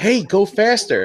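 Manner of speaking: high-pitched urgent order to a pilot; urgent command. Acted. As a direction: call it out like a passenger hurrying the pilot. Acting out an imagined film joke.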